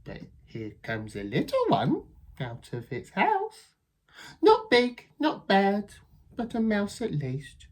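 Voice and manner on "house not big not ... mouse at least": high, loud sneer; scornful Gruffalo child. Acted. Child sneering at a small mouse, high and loud. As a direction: size up the little mouse.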